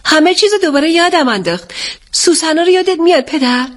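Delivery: high, animated daughter's voice; light up with a sudden memory; excited. A narrator's reading. Daughter asks father if he remembers the lilies, excited.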